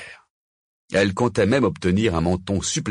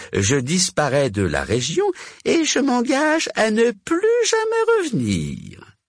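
high-pitched pleading witch, raising the stakes; Vipera; 13 semitones higher, 3 dB louder than usual. A narrator's reading. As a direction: bargain desperately in a high character voice.

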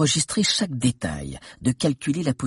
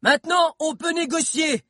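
Bill announcing to the balcony he can negotiate now; triumphant announcement. Acted. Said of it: "annonça Bill".

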